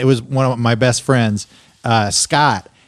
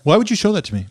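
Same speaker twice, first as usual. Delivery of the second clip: quick, higher-pitched imitation of upset wife; retold upset question; 6 semitones higher, much faster than usual. Wife confronting husband over the camping video, quick retold upset question. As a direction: ask it fast and high, mock-upset.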